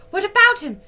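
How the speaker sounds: high, loud anxious question; startled girl pressing; alarmed question. A narrator's reading. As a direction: demand it in fright. Girls alarmed.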